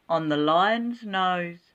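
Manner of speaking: ordinary delivery, nothing marked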